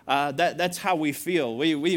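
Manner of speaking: ordinary delivery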